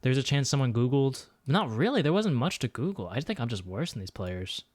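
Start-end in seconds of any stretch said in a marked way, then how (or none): none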